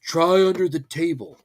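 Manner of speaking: ordinary delivery, nothing marked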